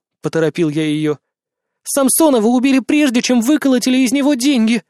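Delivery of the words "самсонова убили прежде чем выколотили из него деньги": frightened urgent. Acted. Frightened woman blurts news.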